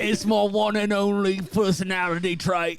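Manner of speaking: high, camp upper-class voice; giddy confessing aristocrat; Sir Chester Hennington. Acted. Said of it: "Sir Chester Hennington".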